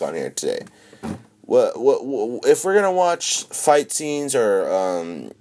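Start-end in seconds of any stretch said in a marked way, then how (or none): none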